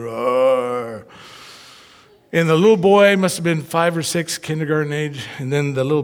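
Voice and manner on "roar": monster roar. Retold or imitated. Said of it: "like a Frankenstein, you know, monster".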